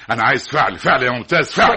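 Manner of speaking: loud, insistent, rising outburst; exasperated demand. Acted. Father demanding action from his son, exasperated and loud. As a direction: drive the repeated word home loudly.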